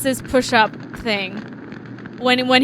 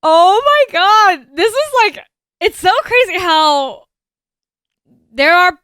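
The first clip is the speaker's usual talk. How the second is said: stunned excitement; 6 semitones higher, 8 dB louder than usual. Real, not acted. Stunned by game's parallel to reality.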